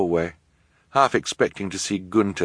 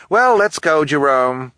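bossy socialite; 9 semitones higher, 7 dB louder, slower than usual. Acted. Esme summoning.

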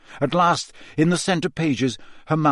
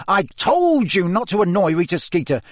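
exasperated telling-off, raised in pitch; urgent scolding hiss; 6 semitones higher than usual. A narrator's reading. Ron rebukes Hermione over Rita Skeeter, exasperated hiss. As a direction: scold a friend, then grope for the word. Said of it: "Ron hissed at Hermione".

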